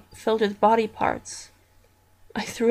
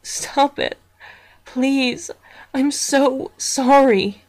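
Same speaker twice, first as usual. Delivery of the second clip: crying plea; 4 semitones higher, 5 dB louder, much slower than usual. Acted. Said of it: "I began to cry and said".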